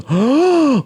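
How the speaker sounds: high, sharp imitated gasp; mock gasp. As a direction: gasp in exaggerated recognition. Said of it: "and then he goes".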